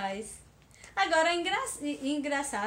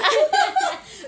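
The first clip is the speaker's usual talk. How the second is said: high burst of laughter; loud laugh; 11 dB louder than usual. Real, not acted. Laughing at hiccuping friend.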